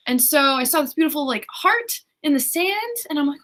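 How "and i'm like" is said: plain reading voice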